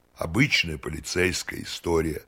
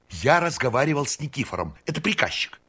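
put-on high, eager voice of an eccentric old count; excited eccentric count; 8 semitones higher than usual. A narrator's reading. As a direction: bubble over with eager excitement.

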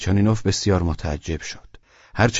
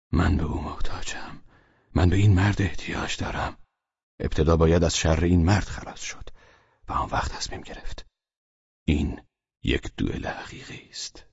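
hushed inner voice, unvoiced; whispered thoughts; 4 dB quieter than usual. Velchaninov resolving silently to fight, in a whisper. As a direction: whisper the plan to yourself.

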